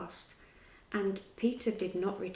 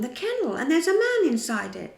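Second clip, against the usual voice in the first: higher, louder, pointing it out; excited cry; 7 semitones higher, 9 dB louder than usual. A narrator's reading. John spotting a man in the kennel, crying out excitedly. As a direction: shout out the discovery. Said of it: "cried John".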